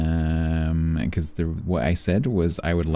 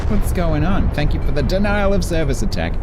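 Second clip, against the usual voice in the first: loud mock outrage; 10 semitones higher, 6 dB louder than usual. Mock outrage at sudden subs.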